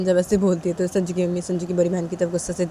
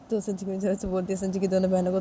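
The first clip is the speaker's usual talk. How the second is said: usual speaking voice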